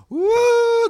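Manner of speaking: high hammed-up woo of a drunk fan; mock cheering whoop. Drunk lady in the audience cheering his story, high mock whoop. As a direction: whoop like a rowdy fan in the crowd.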